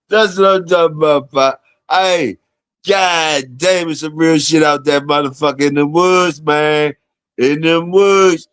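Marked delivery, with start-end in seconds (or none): none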